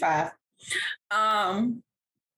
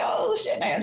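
tag delighted reaction; 11 semitones higher than usual. Retold or imitated. Retells delight at the compliment.

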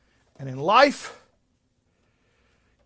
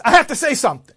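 loud, raised booming announcement; 8 semitones higher, 9 dB louder, faster than usual. Retold or imitated. Frail woman demanding to speak, booming. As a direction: boom it out insistently. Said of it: "adjust the booming voice".

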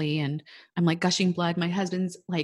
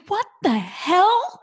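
much higher and louder, slowly dragged out in shock; stunned disbelief; 14 semitones higher, 7 dB louder, slower than usual. Retold or imitated. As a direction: blurt it out in total disbelief. Shock at seeing the heartbeat.

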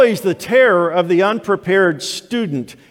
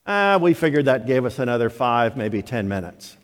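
normal talking voice

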